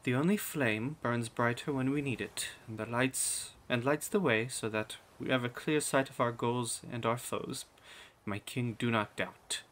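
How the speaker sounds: soft, soothing royal counsel; hushed queenly encouragement; smiling queen. Acted. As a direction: gently reassure the king. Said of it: "the queen, still sitting in her chair, smiles".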